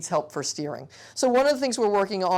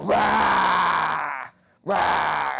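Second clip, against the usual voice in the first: loud playful roar; dinosaur roar; 5 dB louder than usual. Acted. Therapist playing dinosaur for the child, loud mock roar. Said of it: "He's going".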